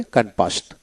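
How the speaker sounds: ক্যানভাসড ending in a t sound; final D as T. Shown as a demonstration. Teacher showing the past ending, ক্যানভাসড with final t. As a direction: close ক্যানভাসড on t, not d. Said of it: "ডিতে ট উচ্চারিত হবে".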